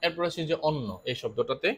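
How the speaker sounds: ordinary delivery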